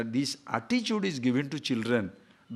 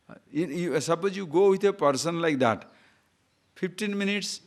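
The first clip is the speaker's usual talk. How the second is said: usual speaking voice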